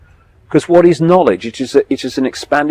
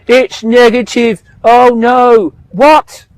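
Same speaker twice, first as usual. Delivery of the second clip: mocking alarmed people; 10 semitones higher, 4 dB louder, slower than usual. Mocking people who fear knowledge.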